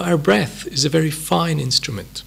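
normal talking voice

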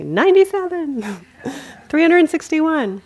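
lightly voiced gleeful teenager tallying; gleeful counting. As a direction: count like a delighted kid. Imitating girl counting judgments.

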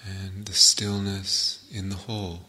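calm meditation guide with long pauses between phrases; guided meditation. Real, not acted. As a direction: settle listeners into stillness, phrase by unhurried phrase.

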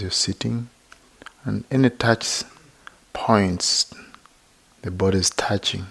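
unhurried, even-toned meditation teacher; guided meditation. Real, not acted. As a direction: gently guide listeners into body and breath, leaving long silences.

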